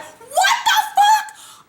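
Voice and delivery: very high, drawn-out squeal of disbelief; hammed-up shriek, stretching every word; shocked. Fan reacting to the jail confession, shocked.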